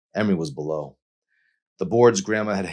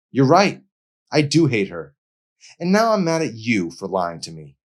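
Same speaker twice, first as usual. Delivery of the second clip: high, loud and furious; angry outburst; 6 semitones higher, 7 dB louder than usual. A narrator's reading. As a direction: spit out the hurt. Emery admits hating her mother.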